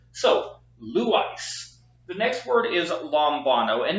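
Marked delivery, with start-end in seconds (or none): 0.8-1.7 slow LOU-Ice, first syllable raised; "Lou" lifted and stressed, "Ice" after it. Shown as a demonstration. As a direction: model "Lou Ice" slowly, weight on "Lou". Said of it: "put the emphasis on that syllable".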